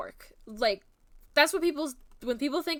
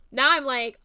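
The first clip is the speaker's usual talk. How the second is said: usual speaking voice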